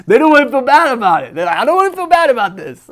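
high, loud words through laughter; laughing while mocking the excuse. Real, not acted. Preacher laughs at a congregant's excuse, high and loud through laughter. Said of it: "I'm sorry I'm laughing".

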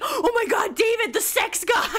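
imitating a fan squealing at a meetup; excited fan. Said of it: "they'd be like".

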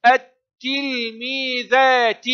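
teacher stretching each syllable of التلميذات; slow syllabled reading. Shown as a demonstration. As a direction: sound out التلميذات as "at-til-mii-dhaa-ti". Teacher sounds out word by syllables.

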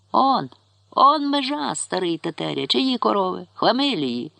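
high, slow protest at first; indignant old man. A narrator's reading. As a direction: stand your ground, outraged. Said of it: "сказав дід".

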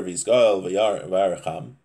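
ordinary talk, nothing marked

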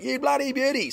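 high-pitched Aussie whoop of joy; yelled cheer. Acted. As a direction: call it out high like a fan. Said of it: "just yell out".

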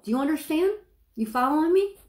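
ordinary talk

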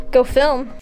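usual speaking voice

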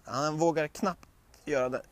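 ordinary delivery

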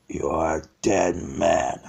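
very deep, slow menace; threatening villain. Acted. Villain answers the bear's challenge, deep slow threat. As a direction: drop far down and draw it out slowly.

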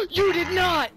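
sharp gasp, then high, loud disbelief; shocked gasp, outburst. Real, not acted. Gamer shocked at what a teammate did, loud disbelief.

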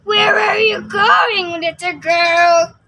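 loud, slow wolf voice; big bad wolf. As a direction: call out slowly like a hungry wolf. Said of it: "She met a big bad wolf who asked".

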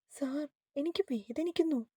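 mild, high-pitched helpless plea; pitiful pleading. Acted. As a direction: plead pitifully through pain. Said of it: "അവൾ ദയനീയമായി പറയുമ്പോൾ".

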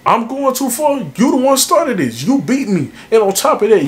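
incredulous, throwing it right back; indignant comeback. Acted. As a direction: pitch jumps up in disbelief at the accusation. Indignant comeback in heated argument.

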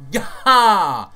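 a loud, hammed-up cheer; sudden burst of excitement. Real, not acted. Vlogger livening up his outro with a burst of excitement. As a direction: jolt the energy up all at once. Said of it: "there's a bit of excitement".

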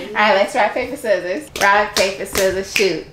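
usual speaking voice

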